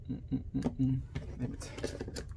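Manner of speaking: muttering a curse to yourself; muttered under breath. Real, not acted. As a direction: mutter it in frustration. Fiddly part won't come off.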